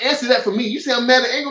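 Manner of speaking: high, demanding angry retort; flipping out. Retold or imitated. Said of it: "I was flipping it".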